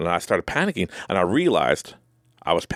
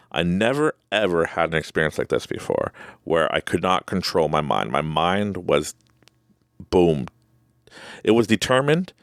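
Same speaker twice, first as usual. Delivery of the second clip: ordinary talk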